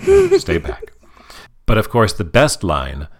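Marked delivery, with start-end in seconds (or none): 0.1-0.9 much higher mock voice; squeaky mock refusal; mimicking Ferb. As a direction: Ferb fending off a hug, high squeak.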